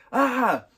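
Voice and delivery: high, loud mock yell; short startled shout, play-acted; imitated scream. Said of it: "And then Greg screams".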